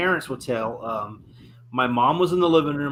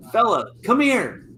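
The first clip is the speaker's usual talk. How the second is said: imitating mom calling out; 8 semitones higher than usual. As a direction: call someone over like mom, pitched high. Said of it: "a voice yelled at my dad in my mom's".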